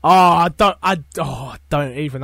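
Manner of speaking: usual speaking voice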